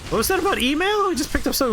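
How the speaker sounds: high-pitched, rushed disbelief; surprised question. Real, not acted. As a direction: shoot up in pitch, then rattle it off. Puzzled by odd item text.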